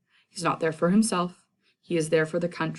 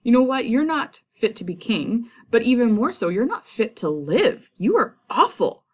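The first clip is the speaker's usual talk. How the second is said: loud, high furious rebuke; passionate outburst; 6 semitones higher, 5 dB louder than usual. Retold or imitated. Macduff condemning Malcolm, loud furious outburst. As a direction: lash out at him in disgust. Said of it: "really passionate outburst".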